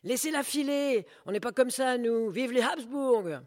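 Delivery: high, loud shouts; crowd shouting. Acted. Crowd shouting patriotic cheers, loud. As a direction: call out proudly over the crowd. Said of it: "La fille a réussi à disparaître".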